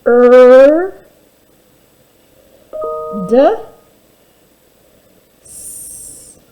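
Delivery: each sound stretched apart; teacher sounding out, loud then whispered; sounded-out letters. Shown as a demonstration. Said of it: "sounding out the word letter by letter".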